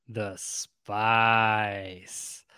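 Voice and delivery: slow, stretched-out mock-reverent echo; drawn-out echo. Host echoing co-host's answer about the crop, drawn out. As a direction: stretch SPICE out long and low-key.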